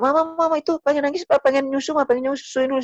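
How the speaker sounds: high-pitched, hurried mock plea to his wife; husband calling out. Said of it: "teriak suaminya". (suami berseru panik, dibuat-buat)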